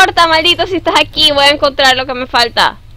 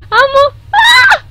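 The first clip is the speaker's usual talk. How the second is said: high, loud frightened scream; real scream; 14 semitones higher, 9 dB louder, slower than usual. Real, not acted. Player jump-scared, screaming in real fright. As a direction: scream in sudden terror. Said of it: "ya estoy gritando como una floja".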